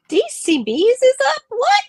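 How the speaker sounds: gasp, then squeal the question up high; surprised gasp. Real, not acted. Host spots a friend joining, high surprised gasp.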